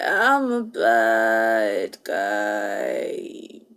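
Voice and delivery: low creaky rough voice; vocal fry. Shown as a demonstration. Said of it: "like this".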